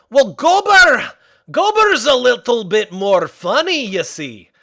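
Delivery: a hint of a cheery Scottish-ish blacksmith; Gobber impression. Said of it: "sounds like Gobber from How to Train Your Dragon".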